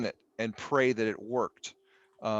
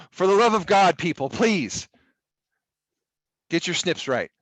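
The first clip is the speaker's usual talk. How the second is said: loud, raised mock begging; exasperated plea; 6 semitones higher, 6 dB louder than usual. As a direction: implore the class in comic despair. Playful plea for better screenshots.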